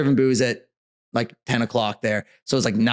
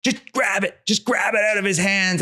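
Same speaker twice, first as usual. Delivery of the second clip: higher-pitched mock yell egging her on; retold yell; 6 semitones higher than usual. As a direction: call out to grab it, pitched up. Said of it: "he starts yelling".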